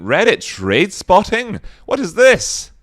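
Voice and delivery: high, loud incredulous question; hammed-up disbelief; mock astonishment. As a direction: leap up in pitch, baffled. Repeats name in mock astonishment.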